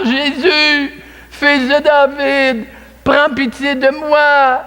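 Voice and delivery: high, slow, insistent cry. A narrator's reading. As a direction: call to Jesus again, pleading harder. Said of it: "Il crie plus fort". (cri plus fort, aigu et lent, qui supplie)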